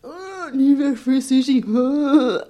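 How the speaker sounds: imitated sing-song heckle; taunting teenagers. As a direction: jeer it slowly from behind someone.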